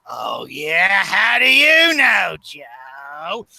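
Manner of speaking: slow mock villain sneer, trailing to a hush; taunting supervillain drawl; Goblin voice. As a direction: sneer it like the Green Goblin toying with Joe. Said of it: "the Goblin says".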